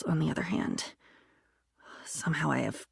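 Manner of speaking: low whispered aside; a soft whispered afterthought. Acted. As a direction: drop into a whisper. Trailing aside.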